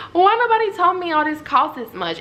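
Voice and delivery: new agent wailing about real estate costs; mock wail. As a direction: whine it like a wounded complaint.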